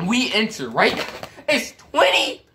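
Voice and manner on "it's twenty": strained high voice. Real, not acted. Building to painful game memory.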